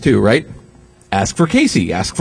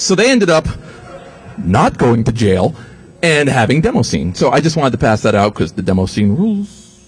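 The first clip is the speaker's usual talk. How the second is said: ordinary talk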